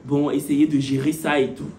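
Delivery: imitation légère, voix basse et posée; un soupçon de directeur hésitant, plus grave; directeur conciliant. Réponse imitée du directeur. (directeur imité, ton bas et hésitant)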